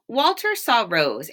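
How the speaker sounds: ordinary delivery, nothing marked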